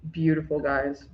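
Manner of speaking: usual speaking voice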